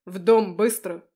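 loud roared command; roaring. A narrator's reading. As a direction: roar the order to run. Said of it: "взревел он". (взревел)

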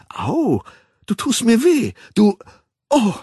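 high, loud yelp of protest; pained cry. A narrator's reading. Woman grabbed roughly by the man, pained cry. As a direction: cry out as if hurt.

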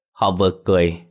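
plain reading voice

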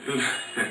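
a quiet amused chuckle; low private laugh; soft chuckle. Real, not acted. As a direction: chuckle softly to yourself. Speaker laughs at the question.

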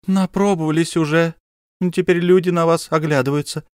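high, reproachful scolding; scolding wife. Acted. Wife scolds her husband, high and sharp. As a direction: shame him in front of guests.

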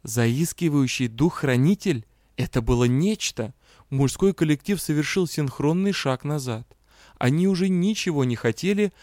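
ordinary delivery

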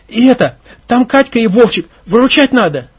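put-on frightened girl begging the gang; urgent pleading. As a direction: beg them to help quickly.

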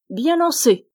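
high, loud mocking compliment; mock praise. Tailor teasing the giant, mock praise. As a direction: tease the giant's throw. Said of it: "dit le tailleur".

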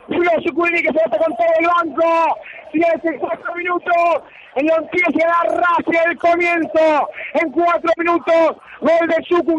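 excited goal commentary; breakneck goal commentary. Real, not acted. Excited post-goal play-by-play.

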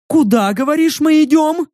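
высокий наглый голосок; с вызовом, свысока; дерзкая девчонка. Acted. (голос наглой девицы)

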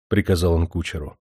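plain reading voice

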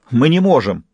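ordinary delivery

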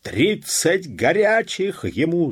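high, loud, drawn-out order; angry shout. A narrator's reading. Police officer orders a flogging, angry shout. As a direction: yell the sentence of flogging. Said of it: "закричал пристав".

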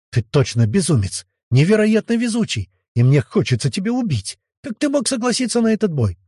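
indignant, with high leaps in pitch; exasperated scolding. A narrator's reading. Elizaveta to the man who risked the fight, exasperated scolding. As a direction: scold him in rising exasperation.

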